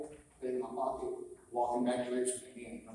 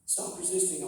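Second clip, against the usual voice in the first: lightly mimicked arresting officer, pitched up; mock cop order with raised pitch; police command; 6 semitones higher, 4 dB louder, slower than usual. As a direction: imitate an officer mid-arrest. Voices arresting officers shouting.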